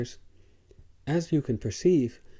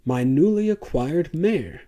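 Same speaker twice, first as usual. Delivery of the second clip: loud, raised furious outburst; raging dealer; 5 semitones higher, 5 dB louder than usual. A narrator's reading. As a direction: rage at the slave. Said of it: "the dealer was raging in Farsi".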